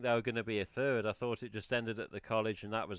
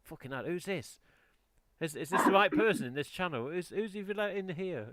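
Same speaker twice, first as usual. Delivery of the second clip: imitated bewilderment, climbing higher; higher-pitched, incredulous retelling; baffled mock alarm; 6 semitones higher than usual. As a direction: play a stranger doubting they're in the right place. Imitating baffled strangers in chat.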